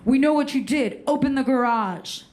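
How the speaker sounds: lightly voiced police officer; a touch of firm authority; stern cop. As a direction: demand it like a cop on the doorstep. Cops confronting her at door.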